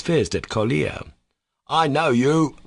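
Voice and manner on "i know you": higher, accusing cry; angry cry. A narrator's reading. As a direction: cry it out angrily at the boy. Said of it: "he cried angrily".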